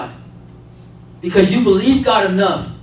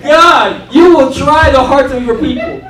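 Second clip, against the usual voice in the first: loud, raised-pitch comic exasperation; exasperated complaint; 5 semitones higher, 7 dB louder, slower than usual. Retold or imitated. Preacher complaining to God that he tries hearts, exasperated. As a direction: cry out to God in mock frustration.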